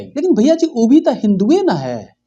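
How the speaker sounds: high-pitched, animated objection; protesting sidekick. Acted. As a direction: pipe up high at the host. (ऊँची आवाज़ में टोकते हुए)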